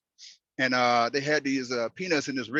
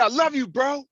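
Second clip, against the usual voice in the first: brother's words; 10 semitones higher, 4 dB louder than usual. Retold or imitated. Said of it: "He said".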